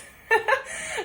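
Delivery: high bright burst of laughter. Real, not acted. Laughing about her mood swings to viewers, a loud burst of laughter.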